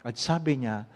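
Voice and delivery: ordinary talk, nothing marked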